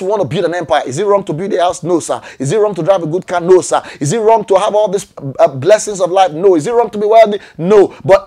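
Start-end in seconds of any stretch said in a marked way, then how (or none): none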